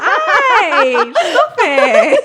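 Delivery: high, loud giggling protest; coy, playful push-away; squealing teasing flirt; mock flirty giggle.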